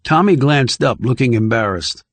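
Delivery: plain reading voice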